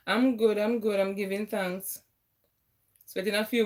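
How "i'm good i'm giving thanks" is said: usual speaking voice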